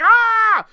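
high, loud frustrated mock scream; exasperated yell; driven-nuts scream. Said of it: "it's, like, driving him nuts".

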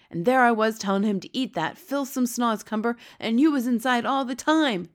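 loud, high-pitched dismay; horrified cry. A narrator's reading. B F G learns Sophie was inside, crying out. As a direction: cry out aghast at the realisation. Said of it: "the B F G cried".

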